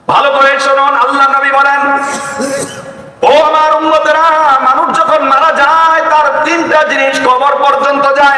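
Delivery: high, impassioned preacher's cry; fervent preaching. Acted. Preacher to the congregation about prayer, fervent high preaching. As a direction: lift the voice and preach to the crowd.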